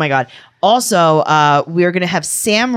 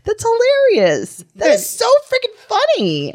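delighted. Real, not acted. Reacting to the Bloomberg laws, a high delighted gush.

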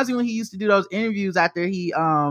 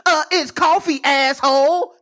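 mock snide barista, higher and louder; 8 semitones higher, 3 dB louder, slower than usual. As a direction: sneer it like a rude barista. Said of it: "You're like".

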